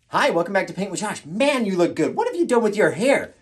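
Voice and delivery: loud, high show greeting; gushing presenter. Acted. Host complimenting the viewer's hair in an intro take, gushing. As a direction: lay on the flattery with full energy.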